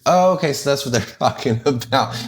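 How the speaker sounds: amused, laughing self-quote; laughing realization. Retold or imitated. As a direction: laugh as it finally clicks. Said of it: "I was like".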